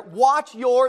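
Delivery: ordinary talk, nothing marked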